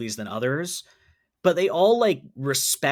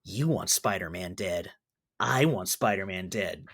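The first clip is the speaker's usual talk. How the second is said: low, quieter creepy reptilian voice; hissing reptile growl; 6 semitones lower, 5 dB quieter than usual. Retold or imitated. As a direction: hiss it like a reptilian monster. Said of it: "hissing kind of, like, creepy reptilian voice".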